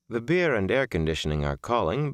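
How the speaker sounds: ordinary delivery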